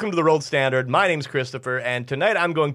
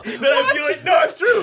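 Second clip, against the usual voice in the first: laughing shout; 12 semitones higher, 5 dB louder than usual. Real, not acted.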